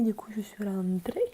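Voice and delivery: whispering. Real, not acted. In bed at night.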